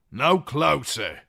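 loud, high, slow warning shout from the walls; ringing call. Acted. Guard on the walls warns off a rider, shouting. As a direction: call down a hard stop. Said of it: "a voice rang out".

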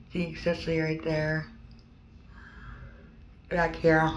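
talking with lip pulled down; speaking with mouth held open; fingers in mouth. Real, not acted. Seen 0: Fingers hold the lower lip down while showing the inside of her mouth.

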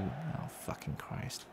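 slow whispered curse under the breath; whispering a curse at the screen; muttered curse. Real, not acted. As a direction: hiss it out in disbelief. Game event angers him.

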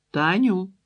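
Таню called out loud and high, falling at the end; Ольга calls Таню from the kitchen, Таню rising high and loud; calling out. A narrator's reading. Mother summoning daughter to supper, Таню loudly called. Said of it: "гукнула Ольга".

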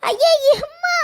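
startled cry. Acted. Girl startled, cries out to mother.

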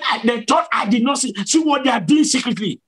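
high, rapid accusation; angry divine voice. Retold or imitated. Preacher voices Jesus exposing secret deeds, fast high retold anger. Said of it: "cover me from his anger".

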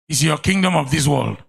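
loud, driving prayer and sermon; full-force pulpit preaching; fervent preaching. Acted. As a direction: pour it out over the congregation.

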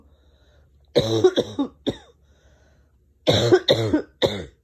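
long deep hacking cough; coughing fit. Real, not acted. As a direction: cough your head off. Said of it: "Just wanted you to hear".

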